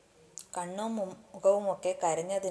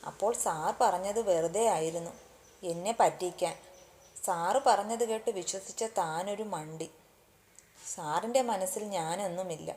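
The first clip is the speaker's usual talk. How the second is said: ordinary delivery, nothing marked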